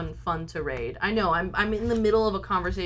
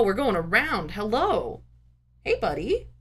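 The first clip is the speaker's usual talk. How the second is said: ordinary delivery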